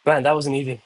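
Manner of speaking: winded after push-ups; panting between words after a set; out of breath. Real, not acted. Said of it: "thirty in a row is hard".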